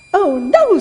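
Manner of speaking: loud, high piglet scream; storybook piglet's loud shriek; screamed alarm. A narrator's reading. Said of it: "screamed the piglet".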